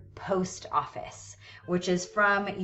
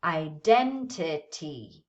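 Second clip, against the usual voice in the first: slow "Identity", DEN held longer; stress on second syllable; 4 semitones lower, slower than usual. Shown as a demonstration. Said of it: "where do you hear that longer".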